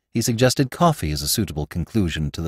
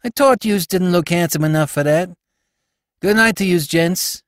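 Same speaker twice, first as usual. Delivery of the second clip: high-pitched ragged youth taking his leave; cheeky Bowery youth; 9 semitones higher than usual. Acted. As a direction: bid them a cheeky Bowery goodnight. Spike mocks the cabmen and leaves.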